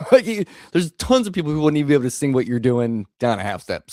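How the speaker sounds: laughing through the words; chuckling as he talks. Real, not acted. Laughing while talking.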